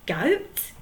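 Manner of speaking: puzzled. Acted. Handkerchief repeating the troll's word, puzzled. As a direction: say "Goat?" as a puzzled query. Pirate puzzled, then outraged.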